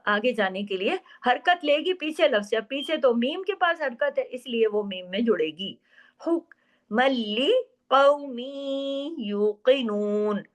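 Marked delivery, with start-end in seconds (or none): 6.3-10.4 very slow chanted recitation; Quran recitation. Shown as a demonstration. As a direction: recite with idgham, drawn out. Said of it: "आगे इदगाम हुआ". (धीमी तजवीद वाली तिलावत)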